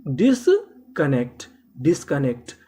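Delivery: Disconnect drawn out slowly, each syllable clear; slow spelled-out word. Shown as a demonstration. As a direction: stretch disconnect slowly like a teacher.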